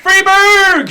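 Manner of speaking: high loud cheering shout; excited. Real, not acted. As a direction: yell the town name with glee. Cheering hometown name.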